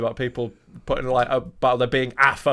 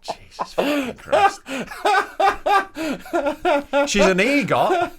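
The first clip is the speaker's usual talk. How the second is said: words through loud real laughter; can barely get it out for laughing; laughing hard while reading the wiki; 12 semitones higher, 3 dB louder than usual. Real, not acted. Helpless laughter at co-host.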